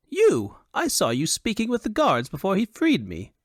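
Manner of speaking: high-pitched, excitable foppish voice; high and animated Elvunian dandy; high Elvunian fop. A narrator's reading. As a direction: play a foolish fop in a high, affected register. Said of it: "he said in a high Elvunian accent".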